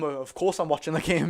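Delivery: ordinary delivery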